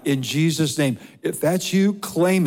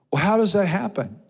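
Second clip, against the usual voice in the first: usual speaking voice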